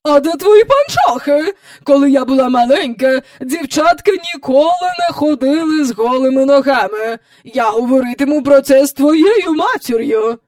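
put-on high, loud, indignant old-fashioned lady; imperious scold. A narrator's reading. Visitor scolding the girl about stockings, imperious. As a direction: lecture the child in outrage.

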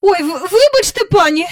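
high, stammering interruption; flustered apology. Acted. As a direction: burst in and stumble over the apology. Flustered cook bursts in apologising.

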